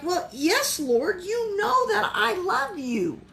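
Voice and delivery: a hint of a put-upon Peter; mildly annoyed. Peter answering Jesus again, lightly voiced mild irritation. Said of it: "getting a little annoyed".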